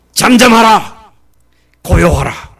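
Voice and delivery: loud slow command to the wind; commanding rebuke. Acted. Jesus commanding the sea to be still, loud and slow. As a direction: rebuke the storm with full authority.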